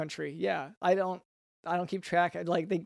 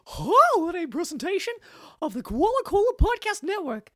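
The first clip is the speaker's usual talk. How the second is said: high, chirpy koala mascot voice; cartoon mascot host; 11 semitones higher than usual. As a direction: play a cheesy corporate mascot host. Mascot character hosting.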